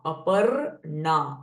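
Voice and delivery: Aparna with a retroflex nasal na, slow and drawn out; Aparna pronunciation. Shown as a demonstration. Teacher modelling Aparna for students, slow and careful. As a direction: say Aparna slowly, the na from mouth and nose. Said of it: "R sound before na".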